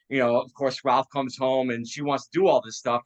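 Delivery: ordinary talk, nothing marked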